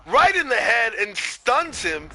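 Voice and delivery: loud, high, shocked sports call; excited play-by-play. Real, not acted. Commentator calls a rock from the crowd, loud excitement. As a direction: shout the sudden hit to the crowd.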